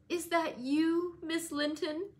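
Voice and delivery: high, slow feeble invalid voice; faint and fretful, as if ailing; sickly Linton. A narrator's reading. Sickly Linton greets cousin.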